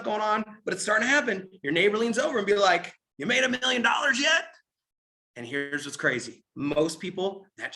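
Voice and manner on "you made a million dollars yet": mock shout, higher; taunting call. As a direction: yell a jeer over the fence. Neighbor taunts over the fence.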